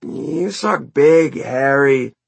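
high, loud, drawn-out jeer; bickering bandmate's taunt. A narrator's reading. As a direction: stretch the insult out mockingly.

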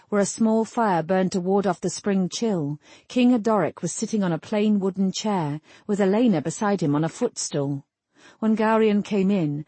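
usual speaking voice

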